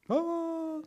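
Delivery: high wailing cry; wife's sob. Retold or imitated. Wife bursting into tears at the flowers, high wailing sob. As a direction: burst into a sudden mock wail.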